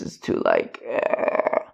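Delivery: testing a voice that sinks too low to hold; too-low whisper voice. Shown as a demonstration. Testing his tired voice, a whisper dropped far too low. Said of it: "if I go like this".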